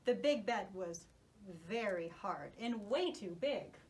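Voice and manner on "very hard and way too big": usual speaking voice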